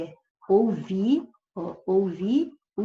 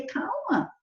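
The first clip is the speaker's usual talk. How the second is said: high, sudden calma; exclaimed warning. Shown as a demonstration. Teacher exclaims calma as an interjection. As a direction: blurt it out on the spot. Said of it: "estou expressando um sentimento".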